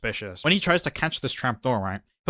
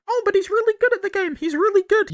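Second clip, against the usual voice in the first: much higher, fast, sarcastic fanboy voice; sarcastic mock voice; 4 dB louder than usual. Mocking defenders of the player, high sarcastic fanboy voice. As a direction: squeak it out as a mocking fan.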